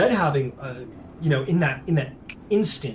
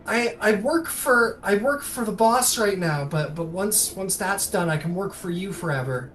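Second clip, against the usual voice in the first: put-on higher, halting kid voice; earnest child; 6 semitones higher than usual. Child offers lifelong service to rescuer, higher earnest voice.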